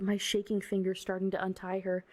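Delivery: plain reading voice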